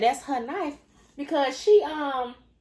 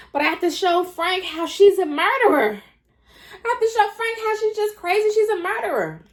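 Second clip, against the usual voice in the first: frantic, higher and louder; panicking, words tumbling out fast; frantic panic; 10 semitones higher, 7 dB louder than usual. Retold or imitated. Jen in the bathroom, frantically resolving to show Frank, panicked shout.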